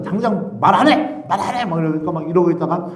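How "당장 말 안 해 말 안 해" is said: high, loud scolding; mock angry parental order; mother threatening. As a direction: imitate a mum demanding a confession. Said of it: "회초리로 바닥을 막 때리면서".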